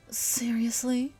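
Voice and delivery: slow, high disbelieving seriously; exasperated character; incredulous. Acted. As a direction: stretch it out in disbelief. Incredulous girl reacts.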